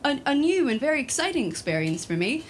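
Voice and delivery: usual speaking voice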